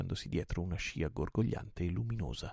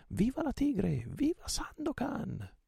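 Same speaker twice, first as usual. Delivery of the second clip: very high, drawn-out cheering; great joyful cheer; slower than usual. A narrator's reading. Crews hail their returned captain, high joyful cheer. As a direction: cheer with joy, voice right at the top. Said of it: "un gran urlo di gioia".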